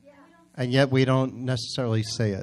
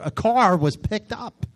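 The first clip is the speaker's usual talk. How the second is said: normal talking voice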